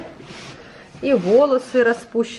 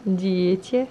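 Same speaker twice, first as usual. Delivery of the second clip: put-on reading to a toddler; 3 semitones higher, slower than usual.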